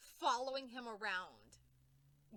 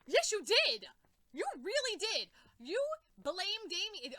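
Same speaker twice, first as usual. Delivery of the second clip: high, loud accusing repeat; furious accusing; 7 semitones higher, 8 dB louder than usual. Real, not acted. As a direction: hammer the accusation. Accusing Batman.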